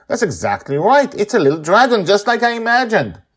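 put-on high, loud delighted little girl; ecstatic child. A narrator's reading. Joy praising Akanyang's flag, ecstatic child voice. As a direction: gush with joy over the drawing. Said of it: "Joy's ecstatic".

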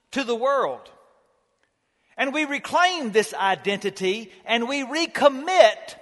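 building sermon delivery with long pauses; preaching. Acted. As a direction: preach it out to the whole congregation. Preaches at full force.

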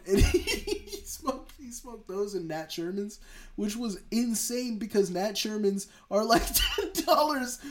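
high-pitched, laughing disbelief; amused, voice pushed high by laughter. Real, not acted. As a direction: keep breaking into laughter while talking. Cracking up over cigarette choice.

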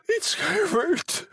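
slow, very high-pitched cry; screaming old woman. A narrator's reading. Mother hearing her son knock, high drawn-out scream. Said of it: "she screamed".

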